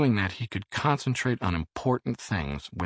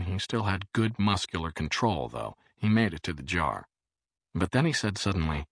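ordinary delivery, nothing marked

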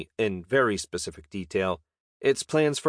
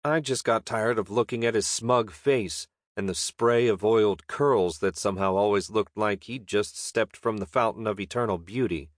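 plain reading voice

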